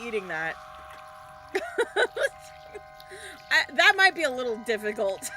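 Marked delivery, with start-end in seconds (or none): 0.6-3.5 breathless wheezing laugh. Real, not acted. Laughs at the idea.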